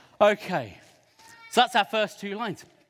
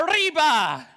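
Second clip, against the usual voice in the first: high, loud Arriba; called answer; 7 semitones higher, 6 dB louder, slower than usual. Shown as a demonstration. Poet calling out Arriba for the children's vote, high and loud. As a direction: cry out Arriba. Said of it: "If you like the second one, go".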